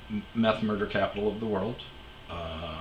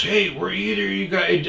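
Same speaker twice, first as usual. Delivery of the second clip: much higher, louder mock yell of a stranger; imitating the dude; 10 semitones higher, 6 dB louder than usual. Stranger yelling at him over the weed eater, imitated high and loud. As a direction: squawk it like the guy who came up yelling. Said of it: "he goes".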